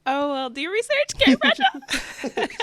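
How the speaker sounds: loud, high teasing through laughter; mock-scold, breaking into a loud laugh; playful scolding. Real, not acted. Host has no clue about Carrie Bradshaw, playfully scolding. Teasing guest for not knowing reference.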